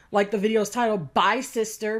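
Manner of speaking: ordinary delivery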